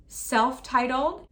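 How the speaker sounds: ordinary delivery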